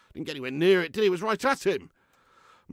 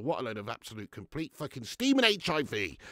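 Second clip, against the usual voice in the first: usual speaking voice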